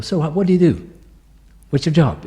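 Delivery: high, loud nosy question; mock curious stranger. New acquaintance asking her job, high and loud.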